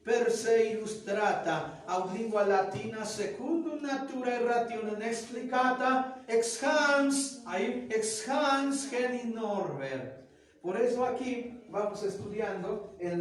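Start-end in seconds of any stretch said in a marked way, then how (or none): 0.0-10.2 slow, louder reading of the Latin title; Latin recitation. Shown as a demonstration. Teacher reads the Latin textbook title aloud, slow and loud. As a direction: declaim the Latin book title carefully. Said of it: "o cuando vamos diciendo".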